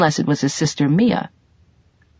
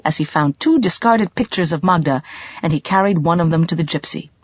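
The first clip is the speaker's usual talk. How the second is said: ordinary delivery, nothing marked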